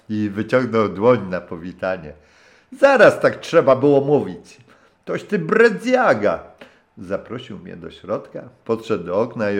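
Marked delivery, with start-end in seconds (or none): 2.8-6.4 put-on high, loud hearty old sailor's voice; a rough old skipper warming to his guest; gruff old captain. (serdeczny, szorstki głos starego kapitana)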